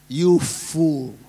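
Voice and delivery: stern rebuke. Retold or imitated. Jesus rebukes the rich fool.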